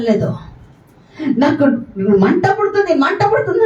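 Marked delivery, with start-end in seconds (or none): 1.4-3.6 high, rising cry of burning pain; demon screaming it burns. Retold or imitated. Pastor voicing the demon burned by the anointing, high wail. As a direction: wail as the spirit feels the fire. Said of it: "అని అరుస్తుంది".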